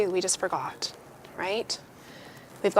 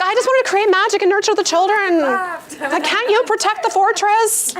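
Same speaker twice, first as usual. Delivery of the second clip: much higher and louder, fed-up tirade half laughing; exasperated venting rant; 9 semitones higher, 5 dB louder than usual. Acted. A woman ranting to the room about doing it all, loud and exasperated. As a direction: pour out the exhaustion, loud and high.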